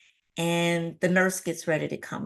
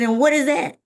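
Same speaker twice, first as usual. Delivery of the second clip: higher, louder baffled demand; alarmed question; 8 semitones higher, 7 dB louder, faster than usual. Retold or imitated. Patient hearing an unknown diagnosis, alarmed. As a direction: question the news in mock alarm. Retold alarm at doctor's news.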